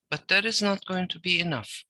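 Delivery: usual speaking voice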